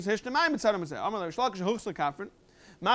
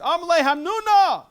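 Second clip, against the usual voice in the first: raised, loud exclamation of admiration; impressed exclamation; 7 semitones higher, 7 dB louder than usual. Retold or imitated. Rabbi answering a student he is impressed with, exclaiming in admiration. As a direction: call it out higher and louder, clearly impressed. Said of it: "he was very impressed".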